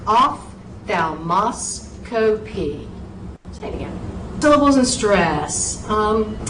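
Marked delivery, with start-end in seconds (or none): 0.0-2.9 ophthalmoscopy said very slowly with a steady beat; rhythmic pronunciation. Shown as a demonstration. Teacher repeats ophthalmoscopy slowly for students. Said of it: "nice little rhythm to it".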